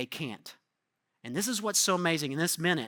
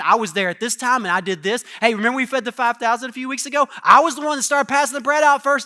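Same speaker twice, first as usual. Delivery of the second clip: loud, higher, bragging jealous rant; 3 semitones higher, 7 dB louder than usual. Retold or imitated. Loud boastful squabble. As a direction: disciples arguing over who deserves the seat, loud. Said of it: "They're like".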